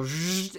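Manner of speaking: buzzing saw noise; imitated saw buzz. Game master describes the saws for players, imitated buzz. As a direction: make a short grinding saw zzz. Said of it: "you hear the saws go".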